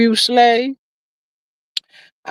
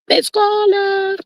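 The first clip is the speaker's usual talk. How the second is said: sing-song call; 9 semitones higher, much slower than usual. Pretend. Teasing call to chat member.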